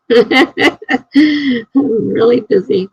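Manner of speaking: laughing while saying it. Real, not acted. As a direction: chuckle through the words. Laughing at her own busyness.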